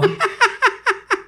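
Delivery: carcajada aguda imitando a mamá; risa de la mamá. Retold or imitated. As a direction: remeda la risa burlona de la madre. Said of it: "Así se rió mi mamá". (risa aguda imitando a mamá)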